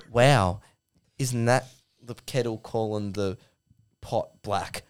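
low, soft, halting reproach; Rants. Acted. Meek co-host reproaches ranting Duck, soft and hesitant. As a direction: respond meekly, with gaps. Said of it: "just a bit simpy. Just a bit weak".

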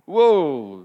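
a loud, falling whoa of shock. Real, not acted. Preacher stunned by Jesus' reply, a loud falling whoa. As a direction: let the harsh answer knock you back. Preacher reacts to harsh answer.

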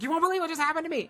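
very high, rapid squeal; thrilled retelling to her pastor; excited. Retold or imitated. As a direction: gush it out breathlessly fast. Texting family, thrilled.

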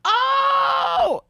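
excited shout. Real, not acted. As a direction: call out in delight. Friend arrives, loud greeting.

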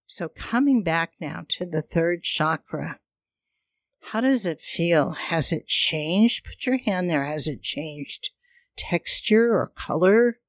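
airy breathy mystical healer. Acted. Leads chakra breathing meditation.

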